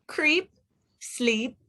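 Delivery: ordinary delivery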